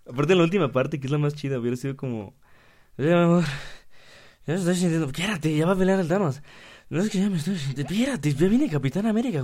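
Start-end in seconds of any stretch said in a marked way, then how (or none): 3.0-6.4 put-on high, loud whiny voice of a girlfriend feeling faint. 6.9-9.4 higher, louder, impatient brush-off; dismissive boyfriend. Pretend.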